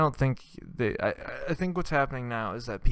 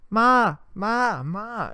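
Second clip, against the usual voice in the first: put-on whiny kid; 11 semitones higher, 3 dB louder, much slower than usual.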